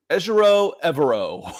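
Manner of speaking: imitating a TV announcer's botched Ejiro Evero; butchered name. Mimicking the announcer who butchered the coach's name, mock mispronunciation. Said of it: "I listened to the announcer butcher".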